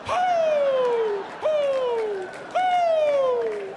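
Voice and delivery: high, loud hoots like in a cave; echoing hoots. Retold or imitated. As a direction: hoot back and forth, echoing. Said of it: "like Ric Flair in a cave".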